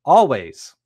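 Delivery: a sudden loud, high, punched "Always"; emphatic answer. Real, not acted. Coach telling listeners when to plan succession, a loud emphatic "Always". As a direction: slam the answer home with one loud word.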